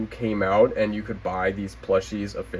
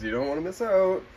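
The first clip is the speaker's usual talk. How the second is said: high sing-song teasing promo; teasing plug; 8 semitones higher, faster than usual. Acted. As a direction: coax them playfully. Teasing plug for last video.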